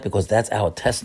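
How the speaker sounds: ordinary delivery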